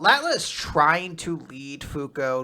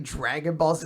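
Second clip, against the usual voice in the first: ordinary delivery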